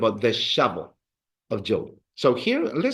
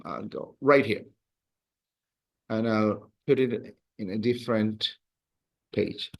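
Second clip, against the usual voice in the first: normal talking voice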